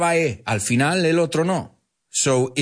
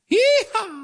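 high, whooping cowboy call; cowboy yeehaw. Acted. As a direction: holler it like a cowboy on a horse. Cowboy joke.

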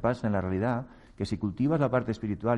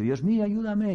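mild plea; 8 semitones higher than usual. Acted. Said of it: "Y dice".

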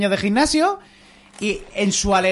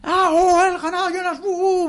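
much higher, hammed-up cries of joy; imitated giddy cheering; 13 semitones higher than usual. Mimicking his friend's joy at beating Jonas, squealing much higher. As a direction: squeal it like an overexcited winner. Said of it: "en esa alegría y gozo".

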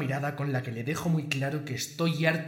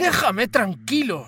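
much higher, louder, slow yell; furious shout; 8 semitones higher, 9 dB louder, slower than usual. Acted. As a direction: bark him out of the room. Boss yells at his bodyguard.

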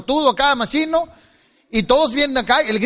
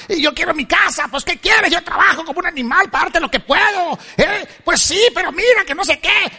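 higher, heated back-and-forth bickering; mock argument; 4 semitones higher than usual. Couple fighting in the car, mock heated bickering. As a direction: play both sides of a marital spat, a little heated. Acted car quarrel with wife.

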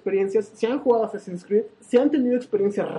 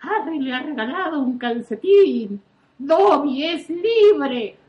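voz de elfo doméstico jubiloso, alargando el final; higher, drawn-out cry of a freed house-elf; Dobby; 4 semitones higher, slower than usual. Acted. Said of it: "Dobby es el elfo doméstico ... que dice". (imitación de Dobby, eufórico y alargado)

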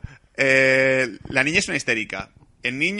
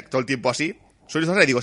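normal talking voice

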